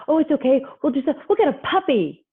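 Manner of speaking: high-pitched mock placating voice; over-soothing parent. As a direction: imitate a parent rushing to cheer a child up.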